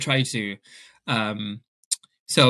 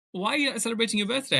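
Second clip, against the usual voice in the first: incredulous friends; 10 semitones higher, faster than usual. Retold or imitated. Friends asking about birthday.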